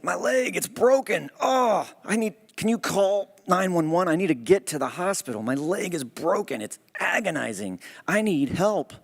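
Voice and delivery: high, pained plea for help; pained pleading. Acted. Injured husband begs his wife to call emergency, high pained pleading. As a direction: beg her to call for help, hurting badly.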